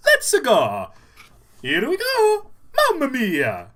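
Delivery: put-on high, excited Italian-accented Mario; Mario impression. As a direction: bounce it out like Mario. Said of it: "but you're nailing it".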